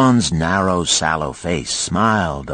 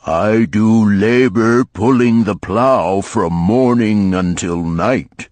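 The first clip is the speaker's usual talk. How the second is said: bemoaning ox; 5 semitones higher, 4 dB louder than usual. A narrator's reading. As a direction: drag out the complaint like a weary ox. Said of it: "he did hear the ox bemoaning to the ass".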